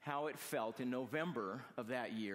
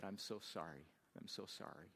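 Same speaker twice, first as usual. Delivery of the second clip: mild sobbing apology; 4 semitones lower, 8 dB quieter than usual. Retold or imitated.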